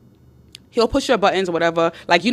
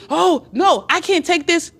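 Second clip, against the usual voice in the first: high-pitched, hammed-up protest; mock yelling rant; 8 semitones higher than usual. Man putting on a show for his girlfriend, mock outburst. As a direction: imitate his meltdown without shouting. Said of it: "all the yelling and screaming".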